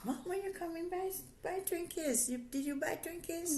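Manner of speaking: put-on high, whiny kid begging; child voice. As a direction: beg like a little kid for Twinkies. Mother mimics child asking.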